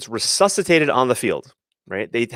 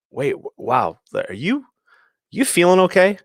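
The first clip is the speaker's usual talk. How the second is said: rising, higher, mock-alarmed "you feeling okay?"; mock concern; 8 semitones higher than usual. Shown as a demonstration. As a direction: act suddenly worried about them, pitch climbing. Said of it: "So now I come back a little later and I go".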